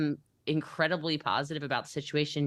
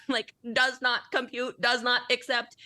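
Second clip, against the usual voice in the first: high, slow mock computer voice; does not compute, like a machine; robot brain; 8 semitones higher than usual. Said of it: "my brain went".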